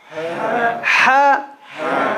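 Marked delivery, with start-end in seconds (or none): none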